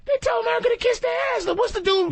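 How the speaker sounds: high and fast, worked up; heated rant. Real, not acted. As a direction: rattle it off in disbelief.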